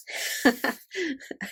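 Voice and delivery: high surprised laugh; pleased high chuckle; delighted laugh. Real, not acted. Finds a washi tower.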